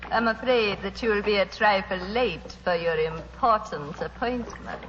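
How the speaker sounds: normal talking voice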